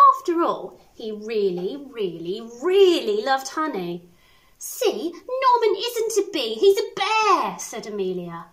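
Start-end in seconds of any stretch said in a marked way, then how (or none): 4.6-7.6 triumphant. Acted. Amelia revealing the truth.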